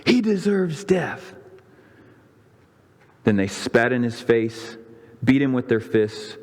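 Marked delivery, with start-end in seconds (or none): 0.1-1.4 high, slow cry of condemnation. A narrator's reading. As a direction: cry it out slowly as an accusing crowd. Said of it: "They all cried out".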